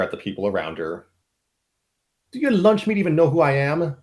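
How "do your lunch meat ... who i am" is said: higher, louder put-on voice; defiant captor. The captor woman sneering at the mercenaries, louder put-on voice. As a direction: sneer it at the whole room.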